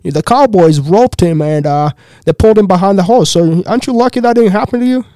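high, loud, slowed-down official; mayor impression. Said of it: "the mayor comes up to me".

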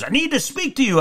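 high, squawky demand; mock a pushy fan in a parrot voice; imitated high-pitched heckler; parrot-voiced woman. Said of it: "a parrot that had a bad voice".

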